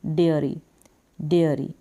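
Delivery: डेयरी said low as de-air-ee; teacher repeating डेयरी; dairy pronunciation. Shown as a demonstration. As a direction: model डेयरी with a light r. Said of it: "क्या बन जाएगा?".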